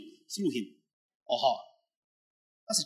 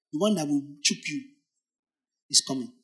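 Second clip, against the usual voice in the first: normal talking voice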